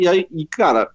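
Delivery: ordinary talk, nothing marked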